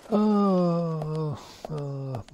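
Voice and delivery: a loud, high, exasperated "oh"; furious groan. Real, not acted. Gamer raging at a missing fence, loud furious groan. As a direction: let the anger burst out at the game. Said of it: "I'm so angry right now".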